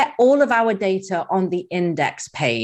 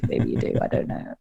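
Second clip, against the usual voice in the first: whispered aside; 6 dB quieter than usual. Real, not acted. Joking aside after sick voice.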